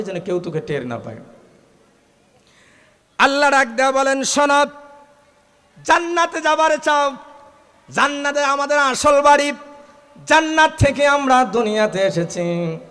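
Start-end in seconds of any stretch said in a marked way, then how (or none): 3.2-12.8 high, loud chanted sermon cry; preaching at full force. Acted.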